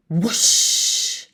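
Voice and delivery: a soft breathy whoosh; storyteller making broom sound; whispered whoosh. A narrator's reading. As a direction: breathe out a swoosh. Broom flies off.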